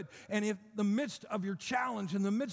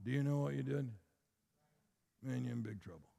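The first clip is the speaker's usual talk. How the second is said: lightly voiced, lower, softer monotone; flat monotone devil; 9 semitones lower, 5 dB quieter, faster than usual. Preacher mocking a monotone devil to the congregation. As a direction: mumble it low and flat, no emphasis. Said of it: "He doesn't come to you going".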